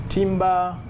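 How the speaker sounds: ordinary talk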